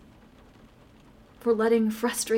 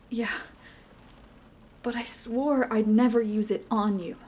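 ordinary delivery

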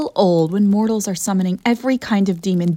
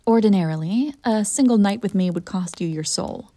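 ordinary delivery

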